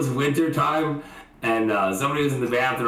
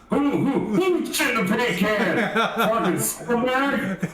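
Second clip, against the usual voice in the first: mock furious dad; 8 semitones higher, slower than usual. Mimicking the furious dad's question.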